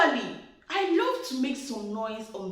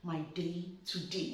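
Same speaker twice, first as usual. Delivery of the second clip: quietly steel yourself; muttered to herself; 5 semitones lower, 11 dB quieter than usual. Retold or imitated. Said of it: "I looked at myself. I said".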